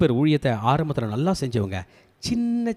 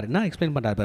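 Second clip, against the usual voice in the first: ordinary talk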